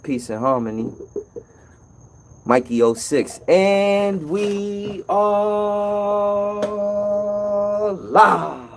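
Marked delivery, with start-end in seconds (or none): 3.5-8.7 long, drawn-out announcer hype; announcer call. Acted. Host announcing to viewers that the show is live, slow announcer drawl. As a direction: stretch the words out like a show opener. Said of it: "announcing the show is live".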